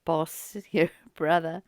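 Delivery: usual speaking voice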